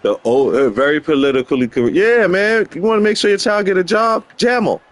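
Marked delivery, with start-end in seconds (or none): 2.0-4.8 high put-on voice; mock advice. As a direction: pitch it up as joking advice. Mock advice on naming a child.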